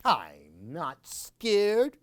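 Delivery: slow, drawn-out and stubborn; little mummy bravely insisting; slow brave insistence. Acted. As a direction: stretch it out to sound brave.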